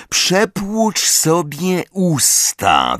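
high, very slow drawl; drawled out contemptuously. A narrator's reading. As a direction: draw out each word at him. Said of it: "wycedził James".

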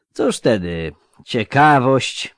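mocking older soldier, drawn out; slow lisping veteran. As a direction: lisp it slowly with sly irony. Szczupak drawls.

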